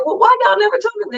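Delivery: high, fast indignant question; protest, why didn't anyone say; husband asking his family, mock indignant; husband's surprised question. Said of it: "as he said".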